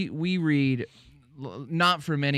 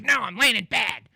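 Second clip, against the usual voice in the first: imitating an annoyed woman, higher and louder; mock indignant woman; 11 semitones higher, 4 dB louder than usual. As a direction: shoot back indignant, voice pushed up. Retold woman snapping back.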